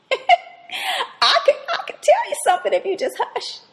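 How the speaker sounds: high laughing squeal breaking through the words. Real, not acted. Preacher laughing at herself before the congregation. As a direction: laugh it out, real and high-pitched. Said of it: "It's so funny".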